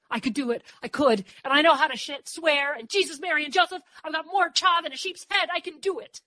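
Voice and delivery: high, loud, insistent rush of pleading; words tumbling out. A narrator's reading. Dor begs to be chosen, words tumbling out excitedly. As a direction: build to a high-pitched boast. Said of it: "Words tumbled out".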